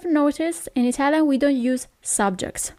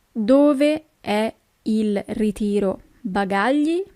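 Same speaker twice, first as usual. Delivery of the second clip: each syllable of "Dove è il ritiro bagagli?" spelled out slowly; slow teaching repeat; slower than usual. Shown as a demonstration. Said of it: "where is the baggage claim".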